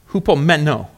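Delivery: hupomeno with the stress on MEN; stress on third syllable. Shown as a demonstration. Preacher introducing the Greek word, hupomeno stressed on MEN. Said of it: "Emphasis on the third syllable".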